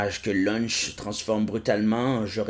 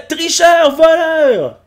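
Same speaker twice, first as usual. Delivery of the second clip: high, loud furious yell; angry cartoon shout; 10 dB louder, much slower than usual. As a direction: yell the accusation in a shrill rage. Personnage crie à l'autre. (cri furieux aigu)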